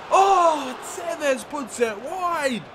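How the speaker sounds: high, drawn-out lament at a miss; agonised near-miss reaction; groaning disappointment. Real, not acted. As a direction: wail it slowly, letting the volume drop. Chance missed.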